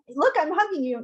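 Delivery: high-pitched, put-on show of affection; mock hugging. Parent faking a hug to a child, mock affection. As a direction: make the hug sound fake.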